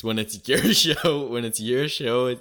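laughing. Real, not acted. Laughing argument with host.

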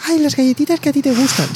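high, squeaky little teddy bear; sugary cartoon bear in an ad; squeaky bear cub voice. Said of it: "con vocecita aguda".

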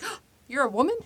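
high, loud startled question; mock surprise. Bernard realizing his friend is a woman, mock surprise. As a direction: gasp it out like it just dawned on you.

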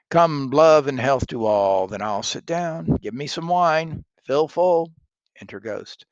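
loud toasting Macbeth; hammy stage actor. Acted. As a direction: raise a grand toast.

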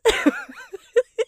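high, delighted laugh; burst of laughter. Real, not acted. Reviewer cracking up at Bert's joke, high laugh.

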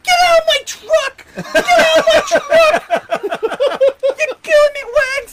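high, loud yell breaking into laughter; laughing mock shout. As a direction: scream him out of the truck, cracking up. Baker yelling at the narrator.

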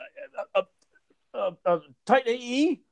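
high, stammering guess blurted out; mock a friend fumbling for the answer; Joe cutting in. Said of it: "Joe will cut me off and say".

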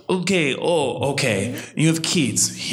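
mock Russian accent, drawn-out start; slow, Russian-accented reaction; older Russian woman. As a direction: imitate a Russian barber mulling it over. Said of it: "this older Russian, obviously Russian woman".

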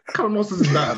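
laughing in disbelief; incredulous, amused laugh. Real, not acted. Mocking a friend's plan.

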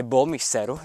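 ordinary talk, nothing marked

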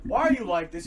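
loud irritated Jason Todd; exasperated yell. Retold or imitated. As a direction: throw it at him in disbelief.

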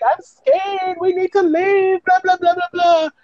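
put-on very high, whiny little girl; hammed-up tearful squeal; mock weeping. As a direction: imitate a scared child wailing. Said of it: "like, weeping".

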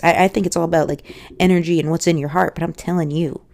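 ordinary talk

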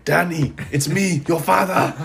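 hammed-up movie dad reveal; Sinbad impression. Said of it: "He says, what is this kid's name?".

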